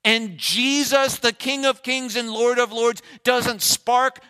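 loud, high preaching cry; booming pulpit declaration; full-force preaching. Acted. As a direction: proclaim it to the whole church.

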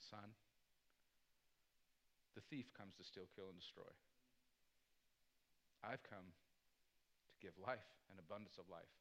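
hushed, low fatherly reassurance; very gentle. Retold or imitated. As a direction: speak very softly and low, with long tender pauses. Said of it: "he said very gently".

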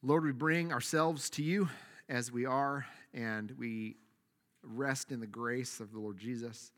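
soft, unhurried prayer voice; hushed closing prayer. Acted. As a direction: pray it quietly to the Lord. Leads the congregation in prayer.

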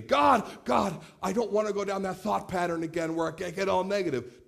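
his younger self asking God for a quick fix; mock prayer-genie plea. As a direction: whine at God for a quick wish. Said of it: "want him to be my prayer genie".

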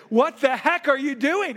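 put-on reliving the moment he lost his temper at the kid; retold angry outburst. Dad yelling at son over spilled pickle juice, mock angry. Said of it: "I'm angry, I'm mad".

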